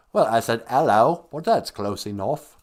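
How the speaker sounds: mock British accent with a broad "'ello"; British worm. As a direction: play a British worm, H dropped. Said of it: "because he was British".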